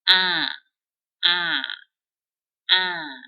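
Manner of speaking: loud nasal "Ah" with the mouth stretched wide. Shown as a demonstration. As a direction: open wide side to side and sound it through the nose. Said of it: "you open your mouth from right to left, wide".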